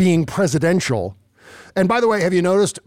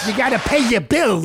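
mocking Trump; 7 semitones higher than usual. Host parrots Trump.

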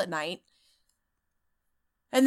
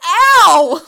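mock yelp of pain; 9 semitones higher, 16 dB louder than usual. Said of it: "And he's like".

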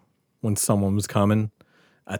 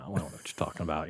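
faster and softer, brushing it off; hushed mock denial; 9 semitones lower, 17 dB quieter, faster than usual. Repeating the denial to Cookie, quick and hushed. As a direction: repeat the denial quickly under your breath. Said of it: "And I'm like".

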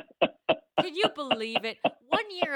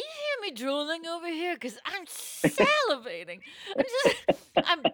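drooly diva voice; 7 semitones higher, 4 dB louder than usual. Acted. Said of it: "instantly, I start talking like this".

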